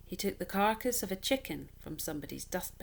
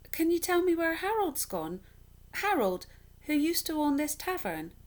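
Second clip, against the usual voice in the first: put-on higher and louder, pleading boy; desperate boy; 10 semitones higher, 5 dB louder than usual. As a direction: press her for an answer, urgent and high. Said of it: "the desperate".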